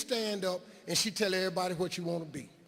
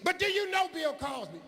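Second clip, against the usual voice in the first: much higher, disapproving teacher voice, imitated; high mock teacher; 10 semitones higher than usual. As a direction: play the shocked teacher, pitched up high. Teacher voice in story.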